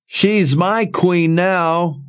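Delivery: high, slow, far-off echo. A narrator's reading. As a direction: call out from afar, drawn out. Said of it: "a voice echoing through the columns".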